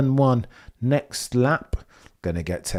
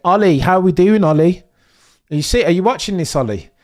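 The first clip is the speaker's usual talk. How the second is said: calling out; 6 semitones higher, 5 dB louder than usual. Real, not acted. Calling out to chat member Ollie.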